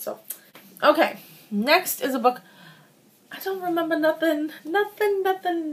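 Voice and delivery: ordinary delivery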